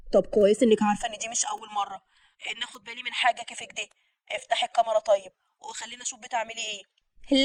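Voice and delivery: soft, slightly low male voice; distrustful lover. Acted. Man asks sweetheart to open the camera, quietly distrustful. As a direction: demand proof quietly.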